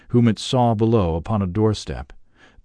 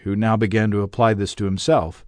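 ordinary delivery

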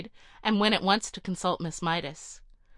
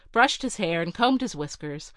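ordinary delivery

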